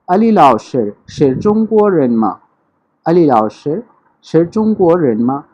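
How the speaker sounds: 阿里老师是中国人吗 drawn out syllable by syllable; very slow classroom demo; slow model question. Shown as a demonstration. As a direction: teach the question slowly, each tone clear. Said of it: "teacher models question slowly".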